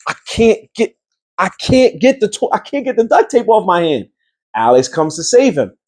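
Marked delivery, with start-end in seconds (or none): 0.1-4.1 high, strained panicky voice; mock panic, stuck to the firecracker; hammed-up high panic; panicked friend. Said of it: "this dude Murph is like".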